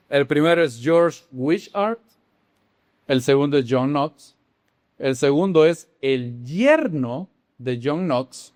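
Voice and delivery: usual speaking voice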